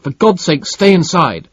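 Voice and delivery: loud, high urgent shout. A narrator's reading. Sherrinford ordering Barbro back in, loud high shout. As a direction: yell her back inside. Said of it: "Sherrinford shouted".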